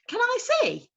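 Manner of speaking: high little owl's question; eager to peek; small voice. Acted. Owlet asking the artist to see. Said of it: "said a small voice".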